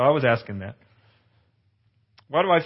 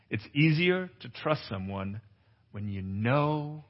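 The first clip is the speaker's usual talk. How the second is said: softer, slow reprise of the main truth; soft slow repeat; 2 semitones lower, 7 dB quieter, slower than usual. Shown as a demonstration. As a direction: let the line sink in quietly. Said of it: "repeated key line".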